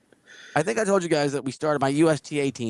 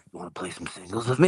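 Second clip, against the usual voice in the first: lower, coaxing mock of a clingy stranger; creepy drifter voice; 6 semitones lower than usual. Retelling the drifter asking him to play, in a lower mock voice. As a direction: lean in like an awkward pest.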